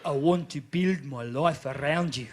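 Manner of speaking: low, slow South African suitor; creepy, drawn-out South African wooing; South African accent. Acted. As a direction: declare love slowly in a deep South African accent. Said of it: "give him that accent".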